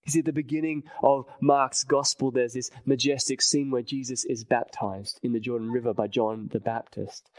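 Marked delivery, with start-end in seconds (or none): none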